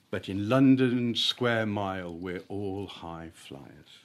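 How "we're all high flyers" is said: ordinary delivery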